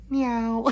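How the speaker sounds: high, drawn-out cat meow; mock meow. Acts out cat noise from chat.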